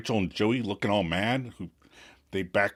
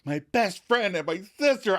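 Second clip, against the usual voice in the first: higher, hurt and accusing; angry accusation; 8 semitones higher than usual. Retold or imitated. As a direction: throw the words at him as betrayal. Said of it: "verbal assault on Chandler".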